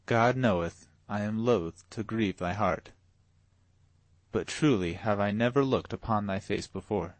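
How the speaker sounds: ordinary delivery, nothing marked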